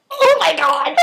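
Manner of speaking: giddy, laughing outburst; overwhelmed fangirl squeal; starstruck gasp. Retold or imitated. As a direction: let it burst out with a laugh. Retold thrilled reaction, laughing.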